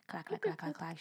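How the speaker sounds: soft, ticking typing noises; imitated keyboard clacking. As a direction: imitate quick keys under the breath. Imitates agent typing at computer.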